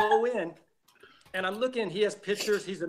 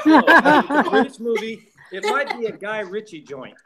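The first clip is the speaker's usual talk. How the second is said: normal talking voice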